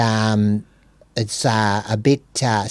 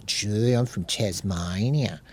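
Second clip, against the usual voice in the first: slow, broad mock Tasmanian, a bit softer; exaggerated Tasmanian accent; 2 semitones lower, 7 dB quieter, slower than usual. Playing a local from Tasmania, exaggerated Tasmanian accent. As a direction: impersonate a Tasmanian, laid on thick and slow. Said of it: "I mean, I'm exaggerating".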